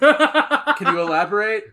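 slow, high question through laughter; laughing. Pretend. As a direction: ask it while cracking up. Mock interview, laughing.

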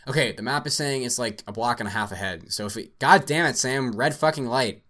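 panicked shout at a red light; yelling driver. Acted. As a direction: yell it as the light turns red. Driver sees red light, curses.

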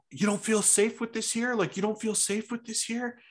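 high, aggressive retold snap; mock snap. Retelling an angry shopper snapping at a cashier, high mock anger. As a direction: jab the question at the cashier. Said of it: "He fucking snaps".

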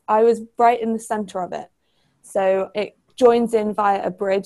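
usual speaking voice

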